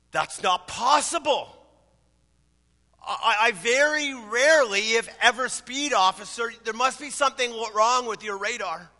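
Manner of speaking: acted husband insisting there's a mistake; indignant denial. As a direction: stammer out a protest of innocence to the officer. Husband protests innocence to officer.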